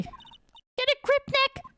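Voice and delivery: put-on high girl sidekick voice. Sidekick Maya urging Nick to pull himself together. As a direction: shout encouragement to Nick.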